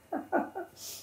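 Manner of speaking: short high laugh at herself. Real, not acted. A widow doing wages laughs at her own surprise, short high laugh.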